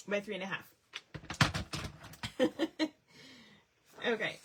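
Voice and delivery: ordinary talk, nothing marked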